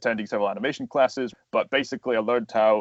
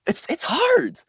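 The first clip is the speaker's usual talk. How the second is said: high-pitched, strained bafflement; baffled frustration; 3 dB louder than usual. Acted. Impressionist struggling to imitate Winnie the Pooh, baffled and high-pitched. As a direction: act out being stumped by a hard impression. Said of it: "it's just like".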